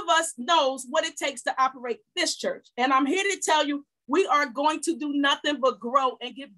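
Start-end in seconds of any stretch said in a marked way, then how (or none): none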